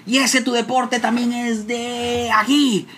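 high, slow contemptuous father; father insulting. Retold or imitated. Father mocking his son's sport. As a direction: drag out the insult. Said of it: "Y insultándome".

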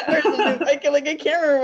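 high laughing words. Real, not acted. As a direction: talk through laughter. Laughing while telling.

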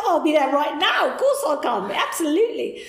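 eager, loud and high acceptance; bursting with glee to say yes; thrilled yes. Retold or imitated. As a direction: leap at the offer with delight.

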